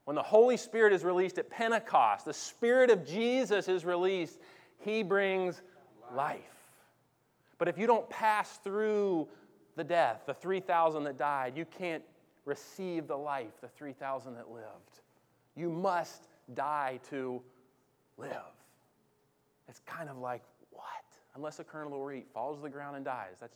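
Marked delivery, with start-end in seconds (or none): 0.0-18.3 loud, driving preacher voice; full-force preaching. Acted. 19.7-20.8 hushed whisper. Real, not acted. Aside in hushed voice.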